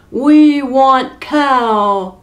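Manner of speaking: a loud, slow, demanding bellow; giants bellowing. Acted. Hungry giants demanding the cow, slow loud bellow. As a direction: draw out each word like a greedy giant. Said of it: "they bellowed".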